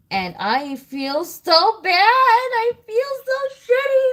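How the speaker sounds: anguished wail. Real, not acted. Guilt over weaning her daughter.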